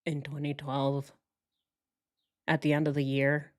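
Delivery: low, flat, emotionless imitation of the husband; monotone robot mimicry. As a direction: drone it out like a robot, no feeling. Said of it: "So he talks like a robot".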